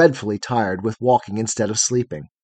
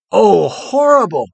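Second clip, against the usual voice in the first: loud, high, drawn-out exclamation; horrified cry; 6 semitones higher, 6 dB louder, slower than usual. A narrator's reading. Photogen reacts to her game with beasts, horrified cry. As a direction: recoil aloud in slow horror. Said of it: "cried Photogen".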